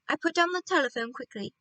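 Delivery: ordinary delivery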